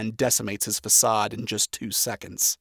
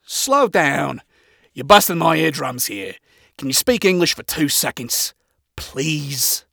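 firm, raised voice; assertive; 2 semitones higher, 5 dB louder than usual. Acted. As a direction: take charge and insist. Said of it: "I assertively say".

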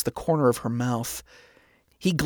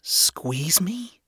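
higher, softer whisper; whispered question; 5 semitones higher, 3 dB quieter than usual. A narrator's reading. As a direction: whisper back in confusion. Charlie whispering back, confused.

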